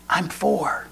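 put-on very soft little-kid voice; whispering child. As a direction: whisper it like a four-year-old. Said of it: "Still whispering".

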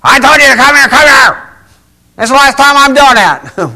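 shrieking mock tirade at a child; mock yelling parent. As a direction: yell it like a fed-up parent calling the kid. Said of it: "they yell at me next time".

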